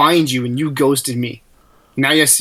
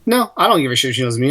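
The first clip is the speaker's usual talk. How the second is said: normal talking voice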